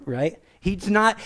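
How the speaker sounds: ordinary talk, nothing marked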